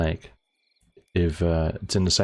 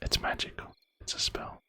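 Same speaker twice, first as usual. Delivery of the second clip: soft unvoiced whisper; 12 dB quieter than usual. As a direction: whisper it conspiratorially. GM whispering mock correction.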